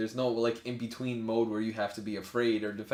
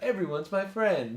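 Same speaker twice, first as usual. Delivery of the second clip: higher, slow, sing-song naive voice; mock naive cheer; 8 semitones higher than usual. Mocking a friendly New Yorker, slow sing-song naive cheer. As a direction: play the clueless optimist. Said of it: "be like".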